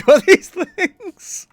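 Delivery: loud, high, breaking into laughter; words dissolving into a real laugh; laughing while talking. Real, not acted. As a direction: crack up mid-sentence.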